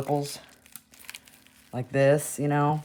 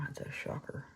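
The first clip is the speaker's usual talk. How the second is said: whispering; 12 dB quieter than usual. Real, not acted. Aside, voiceless.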